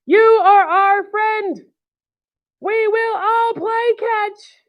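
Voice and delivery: high, slow, resolute declaration; brave Piggy. Acted. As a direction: proclaim each word up high, drawn out. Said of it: "Piggy's gonna be brave".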